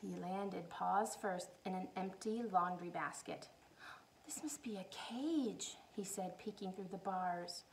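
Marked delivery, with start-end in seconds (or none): 4.2-5.8 soft, slow fearful whisper; scared whisper. Acted. Corduroy trapped in the basket, whispering in fear. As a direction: breathe it, small and scared.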